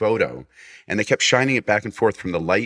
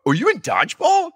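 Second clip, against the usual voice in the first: much higher, louder, imitating a cop who just recognized a star; starstruck cop; 6 dB louder than usual. Cop recognizes the actor he cuffed, imitated excited fan. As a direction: gush it like a fan spotting a celebrity.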